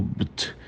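just the faint "bt" ending, on its own; "bt" as a tiny closed B into T; som final isolado. Shown as a demonstration. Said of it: "essa parte final". (só o finalzinho "bt", bem leve)

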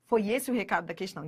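usual speaking voice

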